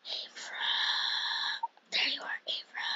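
soft unvoiced whisper. Real, not acted. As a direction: whisper her name, hushed. Calling the friend quietly in game.

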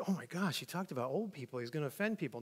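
low, very soft aside; hushed murmur. Acted. Listeners worried he'll offend people, hushed murmur. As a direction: murmur it low, mock-scandalized.

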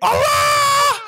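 high frightened shout; panicked scream. Real, not acted. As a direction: yelp in sudden panic. Chased by big heads in game.

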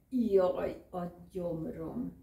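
loud moan of stomach pain. Acted. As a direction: groan out the jaj loudly. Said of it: "nyögte Miska". (Miska nyög a gyomrára, hangos jajjal)

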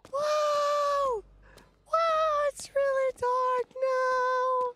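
very high, drawn-out whimpering voice; thin falsetto of nervous dread; high scared whine. Real, not acted. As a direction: squeak it slowly in fright. Frightened by sudden darkness.

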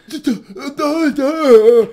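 loud, high, drawn-out mocking duh; exaggerated duh. Shown as a demonstration. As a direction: blurt it out big and high. Mocking economy with drawn-out duhs.

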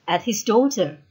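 ordinary delivery

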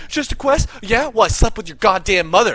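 high, loud incredulous comeback; mock angry retort. [name] taunting the gamer about his mother, angry and hammed-up. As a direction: fire back in scornful outrage.

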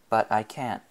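plain reading voice

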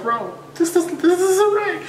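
high, strained complaint; hammed-up upset; mock distressed. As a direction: squeak out the protest. Retold feeling violated.

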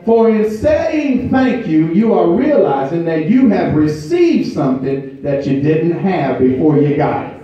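high, loud, rhythmic preaching with held notes; full-force preaching. Acted. Pastor urging the congregation to say thank you, preaching at full force. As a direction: build it up and drive it home to the church.

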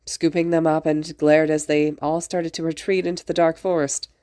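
plain reading voice

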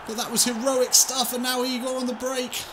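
high, loud gasping call; excited commentary. Real, not acted. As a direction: shout the goal-line clearance in disbelief. Goal-line clearance commentary.

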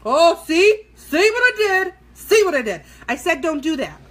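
loud, high exclamation at own mistake; exasperated outcry. Real, not acted. Vlogger botches what she warned against, loud exasperated outcry. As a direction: cry out, high and loud, then ease down slightly.

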